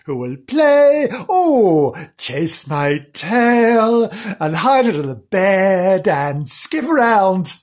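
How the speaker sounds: high, loud, slow and yearning; theatrical longing. Acted. Lonely character listing favourite games, loud drawn-out yearning. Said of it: "he kept sighing".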